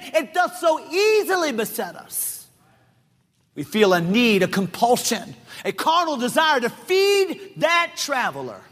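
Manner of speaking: high, ringing preacher's cry; full-force preaching. Acted. Preacher to congregation, preaching at full force. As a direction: preach it hot to the church.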